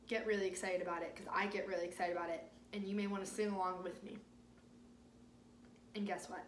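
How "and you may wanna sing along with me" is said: plain reading voice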